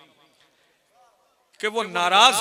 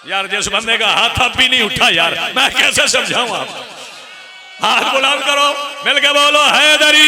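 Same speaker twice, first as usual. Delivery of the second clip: loud, rising call to the congregation; full-voiced cry, last word held long; rallying shout; 5 semitones higher, 11 dB louder than usual. Real, not acted. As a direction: whip the crowd up into the slogan. Rallies congregation to chant slogan.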